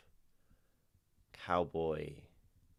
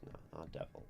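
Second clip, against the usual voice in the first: normal talking voice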